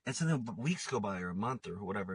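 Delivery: ordinary delivery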